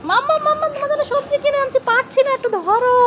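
put-on high, soft childlike voice calling mama; little child voice. Mother voicing her little daughter bringing home vegetables, high childlike. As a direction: pretend to be the little girl struggling with the bag.

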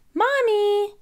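high, loud call for Mommy. Acted. Peppa runs inside and calls out to Mommy loudly. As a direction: shout across the room for Mommy. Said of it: "cried Peppa".

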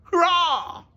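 high, loud cheer; crying out. Acted. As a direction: shout it out in triumph. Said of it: "Stanley cried out".

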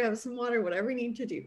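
ordinary talk, nothing marked

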